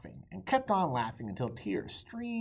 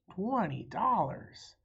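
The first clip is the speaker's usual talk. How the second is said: surprised; 9 semitones higher, 4 dB louder, much slower than usual. Acted. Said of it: "Amelia surprised by offer".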